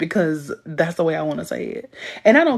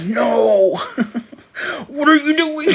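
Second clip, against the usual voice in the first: laughing quote; 8 semitones higher, 3 dB louder, slower than usual. Retold or imitated. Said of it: "he was like".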